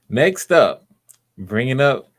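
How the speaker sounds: big stretched-out name introduction; announcer introduction. Real, not acted. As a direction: announce the next act to the room. Host announces the next performer.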